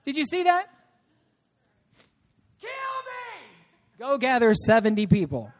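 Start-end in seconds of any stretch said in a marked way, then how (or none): 2.6-3.5 very high, soft falsetto cry; hammed-up high-pitched plea; mock desperate wail. Comic acting of Moses pleading. 4.0-5.5 low, matter-of-fact command; calm lower-pitched instruction; unmoved reply. Acted. God answering, ignoring the wail.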